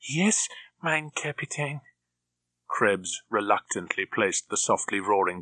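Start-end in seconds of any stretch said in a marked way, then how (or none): 0.1-1.8 slow, higher German henchman voice; grudgingly obey the captain; reluctant German henchman. A narrator's reading. Krebs reluctantly obeying his captain in a German accent.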